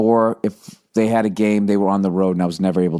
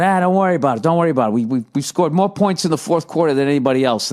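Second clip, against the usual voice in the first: Larry the Cable Guy; 6 semitones higher, faster than usual. Retold or imitated. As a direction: reassure him like a laid-back buddy. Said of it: "And Larry kept going".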